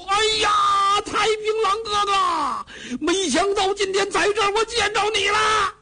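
high, wailing sobbing shout; crying out. Acted. Cheng Yaojin sobbing to his long-lost brother, high crying shout. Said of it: "咧着嘴就哭".

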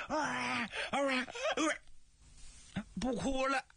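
put-on girl breaking off her crying; sobbing girl stops. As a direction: suddenly cut off the tears.

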